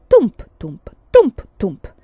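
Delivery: loud rhythmic thumping; strong beat, weaker echo; bench thumps. A narrator's reading. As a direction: bang out the rocking bench in pairs. Imitated rocking bench.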